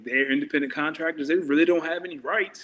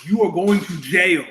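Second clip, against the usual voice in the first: loud echo; 9 dB louder than usual. Real, not acted. Repeating line.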